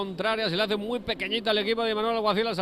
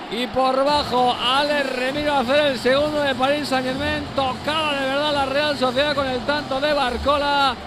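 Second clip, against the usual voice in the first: roaring goal call, higher and louder; breakneck goal commentary; 5 semitones higher, 8 dB louder than usual. Acted. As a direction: shout the goal like a live commentator.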